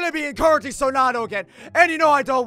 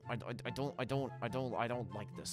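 nervous stammer; 13 semitones lower, 18 dB quieter than usual. Acted.

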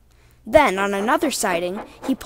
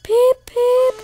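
high, loud engine peep; imitated little train whistle, piped out high; spluttering whistle; 5 dB louder than usual. A narrator's reading. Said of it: "he spluttered".

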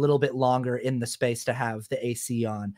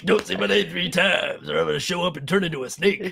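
Beetlejuice movie voice; 4 semitones higher than usual. Retold or imitated. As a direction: drag out the warning, mock spooky. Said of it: "That's what he says through the whole movie".

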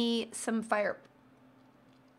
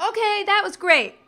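sarcastic; 9 semitones higher, 6 dB louder than usual. Real, not acted. Sarcastic verdict on snack.

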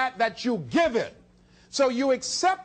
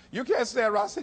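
ordinary talk, nothing marked

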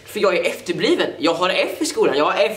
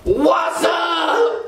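much higher yelled greeting; shouting; 9 semitones higher than usual. Acted. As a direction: yell it as the bursting-in student. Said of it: "jag ska sluta skrika". (skrikande elev som rusar in)

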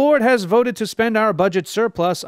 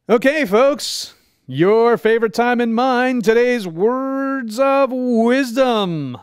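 high, slow, grand announcement; excited announcer; 6 semitones higher, slower than usual. Acted. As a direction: stretch it out like a showman. Announcer opens wisdom segment.

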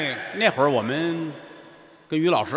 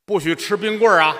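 stern teacher; 4 semitones higher, 4 dB louder than usual. Retold or imitated. Teacher voiced in crosstalk story.

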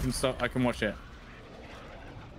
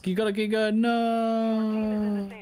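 drawn-out urgent no, higher; urgent stretched-out warning; urgent call; 7 semitones higher, much slower than usual. Real, not acted. Urgent call under sniper fire.

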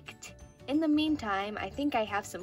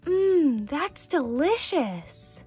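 loud, higher-pitched mmm, then slow relish; delighted tasting; 5 semitones higher, 5 dB louder, much slower than usual. Acted. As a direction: savor the bite with drawn-out delight. Tasting the baked brownie.

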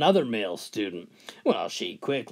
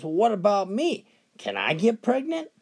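put-on little girl voice; 6 semitones higher than usual.

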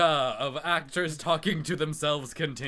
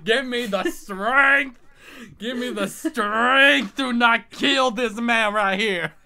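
high, loud, slow Southern cry for strength; loud Southern accent, begging heaven for patience; yelled southern accent; 11 semitones higher, 13 dB louder, slower than usual. Acted. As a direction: bellow it to the Lord in a Southern accent. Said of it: "say really easily in a southern accent".

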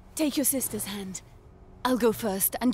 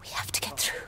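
whispering. Acted. Hiding from soldiers.

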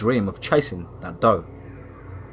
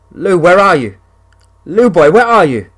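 loud, high calling out; yelling for Lou; 7 semitones higher, 8 dB louder than usual. A narrator's reading. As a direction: call out into the empty dream. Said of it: "I yell".

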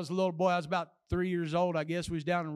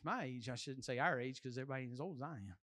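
ordinary talk, nothing marked

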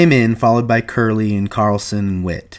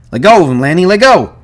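high, loud command; alarmed shouted order; urgent shout; 10 semitones higher, 8 dB louder, faster than usual. A narrator's reading. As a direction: yell to make him stop. George orders Lenny to stop.

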